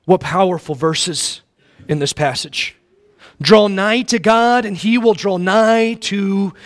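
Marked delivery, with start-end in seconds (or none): 3.4-6.5 scripture proclaimed. Acted. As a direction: declaim the verse slowly. Reading verse with emphasis.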